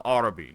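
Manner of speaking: "Arabi" opening on a broad AH; AH-rabi pronunciation. Shown as a demonstration. Player correcting himself on a faction name, "Arabi" said AH-ruh-bee. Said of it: "I should start calling them".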